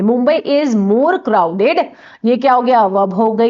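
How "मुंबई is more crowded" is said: slow, word by word; slow dictation. Shown as a demonstration. Teacher dictating example sentence to class, slow and deliberate. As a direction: spell out the example sentence slowly for students. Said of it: "तो is लगा दिया मैंने।".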